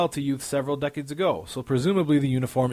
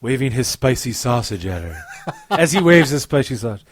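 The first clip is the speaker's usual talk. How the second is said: sultry paperback narration; lightly voiced romance reader; romance novel narrator; 3 semitones lower, 4 dB louder than usual. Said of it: "my romance novel voice".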